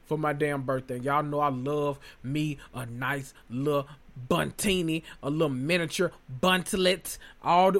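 ordinary delivery

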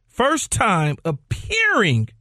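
drawn out with pauses; measured and emphatic; slow, deliberate. Real, not acted. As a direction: spell out each word for the listeners. Repeating his point step by step.

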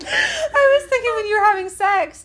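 very high squeaky voice, cracking up; real helpless laughter. Real, not acted. Explaining her misunderstanding to friend, squealing with laughter. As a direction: squeak the words out through laughter.

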